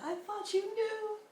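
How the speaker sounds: high, teary imitation of the line; tearful quote. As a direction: quote it as if about to cry. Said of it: "Oh, that moment".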